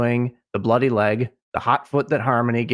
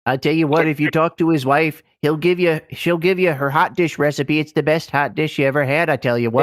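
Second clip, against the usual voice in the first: put-on Minnesota accent; 5 semitones higher than usual. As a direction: ham up a Minnesota accent, chatty and proud. Joins the Minnesota accent bit.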